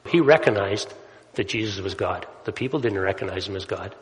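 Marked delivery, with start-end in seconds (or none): none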